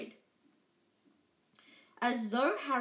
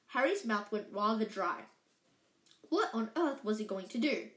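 usual speaking voice

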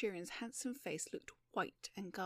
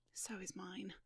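whisper; 8 dB quieter than usual. A narrator's reading. Whispered reply in dark.

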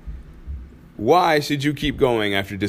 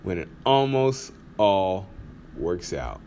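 slow title read, fading off at the end; trying out the title; 4 semitones lower, 5 dB quieter, slower than usual. Shown as a demonstration. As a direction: savor the new title slowly, then let it drop away. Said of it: "that just rolls off the tongue".

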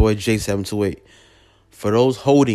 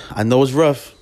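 louder, pressing the point; emphatic; 3 semitones higher, 4 dB louder than usual. Real, not acted. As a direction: say it firmly and loud. Repeats the line, then fades it.

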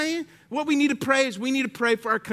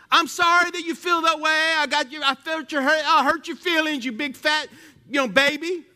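louder, higher sneering sorry; mocking fake apology; 6 semitones higher, 4 dB louder than usual. Shown as a demonstration. Pastor mocking a jerk's fake apology, loud and sneering. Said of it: "That's not an apology either".

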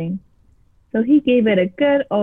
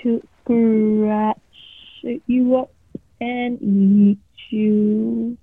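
drawn-out monster threat, very slow at the end; menacing creature; much slower than usual. A narrator's reading. Creature threatening the frightened boy, slow menace. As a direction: draw out eat you like it's hungry. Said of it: "the creature answered".

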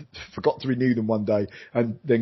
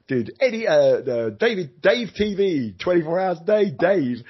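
[name]; 7 semitones higher, 3 dB louder than usual. Retold or imitated. Rock star talking up his own TV channel, high. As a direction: ham it up like an eighties rock frontman. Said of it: "[name]".

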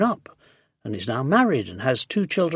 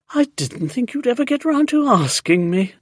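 delighted; 7 semitones higher, 4 dB louder than usual. A narrator's reading. Accepting proposal delightedly.

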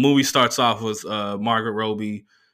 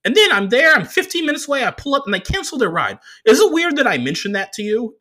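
much higher, louder nerdy driver voice; the driver's voice; 13 semitones higher, 5 dB louder than usual. Retold or imitated. Comedian doing the driver's voice for the crowd, high and squeaky. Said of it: "I'm doing his voice how he sounded to me".

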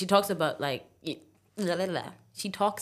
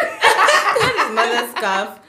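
laughing; 6 semitones higher, 10 dB louder, much slower than usual. Real, not acted.

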